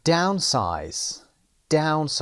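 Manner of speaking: downsize with down said loud and slowly; stress on DOWN. Shown as a demonstration. Teacher models downsize for students, stressing DOWN. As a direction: punch the down in downsize. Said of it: "استرس در قسمت اول".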